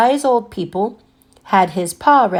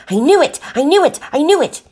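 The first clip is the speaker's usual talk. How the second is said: loud, high, triumphant outburst; hopping mad; 7 semitones higher, 5 dB louder, faster than usual. A narrator's reading. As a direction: shout it, furious she was right. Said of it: "She was hopping mad".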